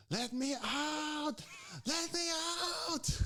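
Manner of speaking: very high, drawn-out strained cry; mock wail for rescue; imitated freak-out. Old patient smacking ambulance cabinets, imitated high panicked cry. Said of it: "she starts freaking out again".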